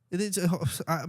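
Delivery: usual speaking voice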